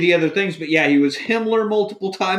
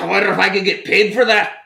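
weak, uncertain Scottish accent; half-hearted Scottish accent; attempted Scottish accent; 5 semitones higher, 3 dB louder than usual. Shown as a demonstration. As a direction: try a Scottish lilt and miss. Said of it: "I don't think I have a Scottish accent".

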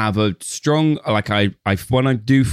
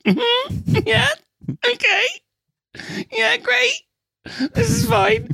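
much higher, sarcastic "this is fine" voice; mock fake calm; slower than usual. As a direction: pretend to be totally fine, pitched way up. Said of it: "I was there like".

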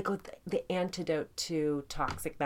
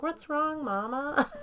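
put-on high, little-kid voice; high imitation of a kid calling Mama; child asking mom; 9 semitones higher, slower than usual. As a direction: voice a concerned small child, pitched way up. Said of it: "and Cole was like".

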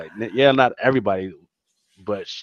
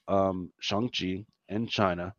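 ordinary delivery

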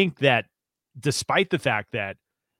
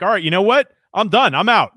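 mock quitting; 8 semitones higher, 6 dB louder than usual. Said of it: "I'm like".

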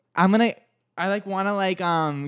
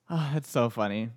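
ordinary talk, nothing marked

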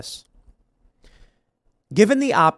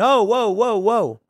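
mock alarm; 6 semitones higher, slower than usual. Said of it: "And they went".

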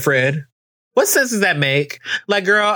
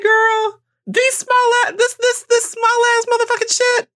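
mock outraged. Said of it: "'Cause I was just like".

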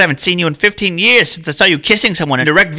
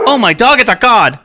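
startled yelp; 6 semitones higher, 5 dB louder than usual. Acted. Startled by an animal inside.

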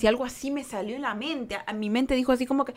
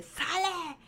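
high but held-back cry of fury; a contained yell of courage, no fear; retold angry yell; 6 semitones higher, 7 dB quieter than usual. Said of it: "de un coraje, no de miedo". (grito de coraje contenido)

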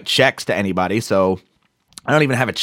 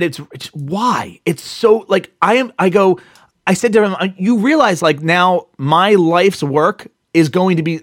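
angry retort; 6 semitones higher than usual. Retold or imitated. Retelling an angry rant at the bank rep, raised and heated. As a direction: let it rise into a heated complaint. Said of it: "I was really, really angry".

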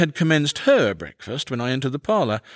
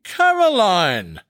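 slow, raised, shocked name-call; astonished exclamation; 6 semitones higher, much slower than usual. A narrator's reading. Husband astonished at wife's insinuation, slow shocked exclamation. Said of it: "simply ejaculated".

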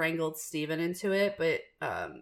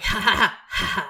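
louder taunting ha ha ha; mocking ha ha; 3 semitones higher, 7 dB louder than usual. Wife gloats at her husband forced to rewatch, mocking laugh. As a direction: gloat over his suffering. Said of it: "I'm like".

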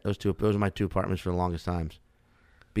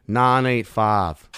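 slow New Orleans "Nine eight five", vowels drawn out and nasal; New Orleans accent; 5 dB louder, much slower than usual. Shown as a demonstration. Comic showing a podcast host his area code, slow New Orleans accent. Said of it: "a person from New Orleans would say that exact same thing".

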